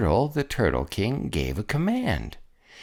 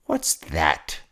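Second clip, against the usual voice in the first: snapped; 5 semitones lower than usual. A narrator's reading. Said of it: "snapped the king".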